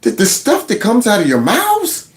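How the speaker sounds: baffled mock outrage, pitch climbing to a high question; imitated disbelief. Voicing a guest stunned by the panelists' talk, incredulous. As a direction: act out her stunned incredulity. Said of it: "She's looking like".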